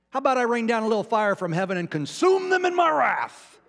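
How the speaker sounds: high, loud booming build to a long WRATH; mock wrathful God. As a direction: play God raining fire, stretching "wrath". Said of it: "say it like that too. Emphasis on the word wrath".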